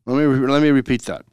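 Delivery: ordinary delivery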